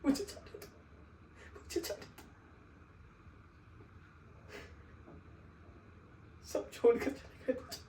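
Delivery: whispered, fading plea; broken whisper. Acted. Begging the sahib to let him go, broken whisper. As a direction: let the begging die to a breath.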